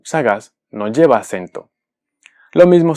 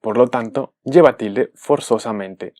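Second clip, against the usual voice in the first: ordinary talk, nothing marked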